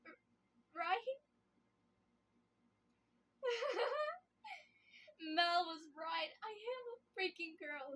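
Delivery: high, broken laugh-sobbing; hysterical laughing crying. Acted. Alone in the forest she breaks down, laughing and sobbing. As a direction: unravel between laughing and crying. Breakdown after killing Mel.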